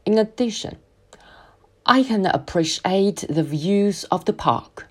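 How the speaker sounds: very slow, pause after "In addition"; slow linker pause. Shown as a demonstration. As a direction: stretch "In addition", hold, then go on slowly. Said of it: "连接词可以停顿并拉伸". (很慢，"In addition"后停顿)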